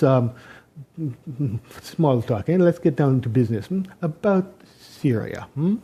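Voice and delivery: a leader phoning the new president, in a mock Russian accent. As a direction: play a smug Putin in a put-on Russian accent, sweetly menacing. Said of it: "excuse my Russian accent".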